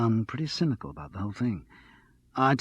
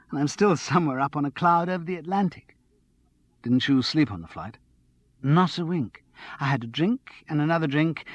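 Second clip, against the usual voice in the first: lightly voiced bubbly flatmate, higher; giddy and a little tipsy, pitched up; a hint of a breathless, excited girl; excited tipsy young woman; 5 semitones higher, 5 dB louder than usual. Andrea on her flight.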